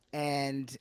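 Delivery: normal talking voice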